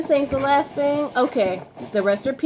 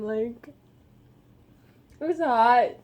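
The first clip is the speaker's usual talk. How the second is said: ordinary talk, nothing marked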